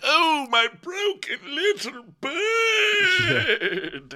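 very high, slow, drawn-out cooing lament; hammed-up motherly wail, last word stretched; Lisa. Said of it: "She's like".

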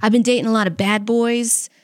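ordinary talk, nothing marked

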